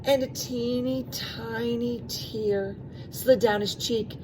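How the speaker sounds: ordinary delivery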